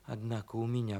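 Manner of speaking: very soft inward musing; thinking to himself. Acted. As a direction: wonder softly to yourself. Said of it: "подумал он".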